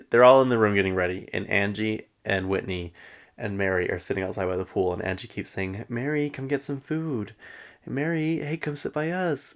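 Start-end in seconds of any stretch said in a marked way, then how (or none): 5.9-9.5 higher, sing-out beckoning; imitated coaxing call. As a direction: lightly mimic calling a friend over. Acted calling friend over.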